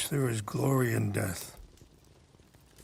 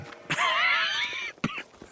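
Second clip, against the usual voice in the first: harsh sick cough. Acted. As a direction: cough hard like a sick man. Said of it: "help you with that cough".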